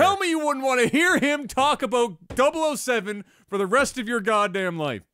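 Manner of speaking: high, loud comic outrage; impassioned insistence. Real, not acted. Podcaster daring his co-host to disagree, impassioned insistence. Loudly champions his absurd pick.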